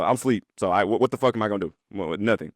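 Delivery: ordinary delivery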